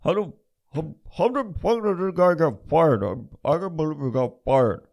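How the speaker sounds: lightly mocked disbelief, pitch up; mocking baffled fans. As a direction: ham up their confusion, just a touch.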